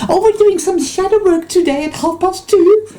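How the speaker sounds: high, put-on airy chirp of a spiritual type; mock spiritual jargon. Mocking people who talk in spiritual jargon, high sing-song put-on voice. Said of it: "These fucking people that talk like that".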